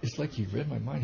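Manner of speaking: ordinary talk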